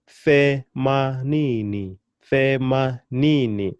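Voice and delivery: themanini very slowly, syllable by syllable, long NI; slow syllable demonstration. Shown as a demonstration. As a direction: spell out the sounds the-ma-NII-ni. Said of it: "I'm still putting my emphasis on the second to the last".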